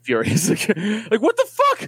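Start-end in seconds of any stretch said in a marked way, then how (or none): 1.1-1.9 much higher, shocked what the fuck; mock outrage at a surgical blunder; outraged disbelief. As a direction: wake up to find your arm gone. Imagines waking missing an arm.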